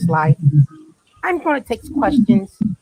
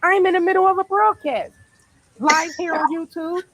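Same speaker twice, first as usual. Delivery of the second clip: higher, dramatic innocent woman; high put-on voice; 8 semitones higher than usual. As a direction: play the startled suspect. Acting arrest skit.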